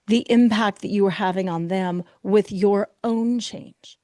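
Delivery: ordinary talk, nothing marked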